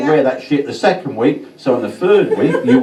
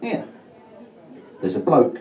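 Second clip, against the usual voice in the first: ordinary delivery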